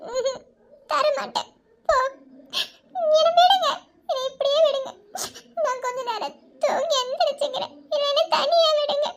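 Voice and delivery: high weeping, pushing them away; crying refusal; tearful refusal. Acted. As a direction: sob stubbornly, begging to be left alone.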